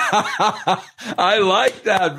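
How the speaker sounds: laughing, drawn-out approval; amused laughter. Real, not acted. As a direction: say it slowly through a high laugh. Laughs at the segment name.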